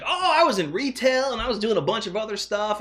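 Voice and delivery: high-pitched, excitable gush; hyper princess. Retold or imitated. Hyper princess answering a question about her old job, hyper. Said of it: "that's how she talks 'cause she's very hyper".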